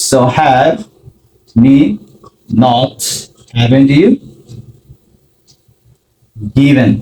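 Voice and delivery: spelled out word by word with long gaps; slow word by word. Shown as a demonstration. Teacher assembling the question for learners, slowly word by word. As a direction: lay each word down separately. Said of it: "ব্যবহার করব have".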